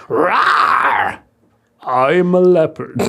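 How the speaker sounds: slow growly toy cat roar; toy leopard voice. Retold or imitated. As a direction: growl it like a squeezed cuddly toy. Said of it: "and it would go".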